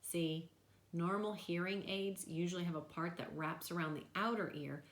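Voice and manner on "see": plain reading voice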